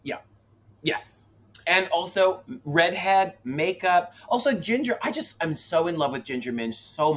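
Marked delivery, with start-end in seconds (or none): none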